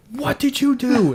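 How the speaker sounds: high, slow outraged demand; retold parental yell, words stretched out; angry dad. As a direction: confront the kids in exasperated disbelief. Retells angry dad confronting kids.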